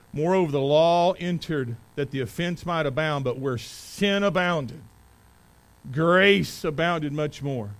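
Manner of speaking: loud, high, forceful scripture reading; preaching scripture aloud. Acted. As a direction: proclaim the verses to the congregation. Reads Romans at preaching force.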